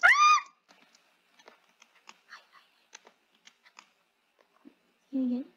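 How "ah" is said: high sudden shriek; startled scream. Real, not acted. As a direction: scream in fright. Caught by the chaser.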